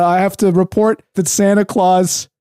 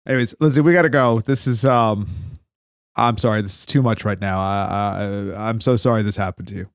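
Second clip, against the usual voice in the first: somber; 7 semitones lower, 5 dB quieter than usual. Acted. Somber sympathy on air.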